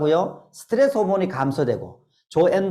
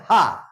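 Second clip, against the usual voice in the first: short demo laugh; one bright forced ha. Shown as a demonstration. Lecturer showing the audience how to laugh, a single ha. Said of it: "한번 저를 따라해보세요".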